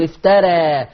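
افترى with the final alif tilted toward e; reduced alif. Shown as a demonstration. Teacher showing Warsh's reading of افترى. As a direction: end افترى on a leaning e vowel. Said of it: "هيقول افترى".

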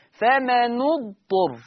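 فمن اضطر with the noon given a kasra, slow and drawn out; Hafs reading with kasra. Shown as a demonstration. Teacher reciting the Hafs reading slowly. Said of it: "شايفين شكل النون؟".

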